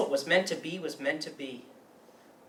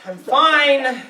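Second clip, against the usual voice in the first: forced "I'm fine"; 5 semitones higher, 10 dB louder than usual. Acted. Hiding distress from dad.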